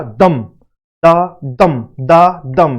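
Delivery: low "दम" beat, then "दा दम" in weak-strong pairs; iambic stress rhythm. Shown as a demonstration. Teacher showing students how the iamb goes, rhythmic stressed chant. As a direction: beat out "दा दम", weight landing on "दम". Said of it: "ऐसे करके जो है".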